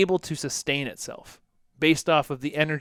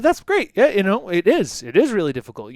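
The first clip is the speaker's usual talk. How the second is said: upbeat validation; 5 semitones higher, 7 dB louder than usual. Retold or imitated. Validating a client's struggle.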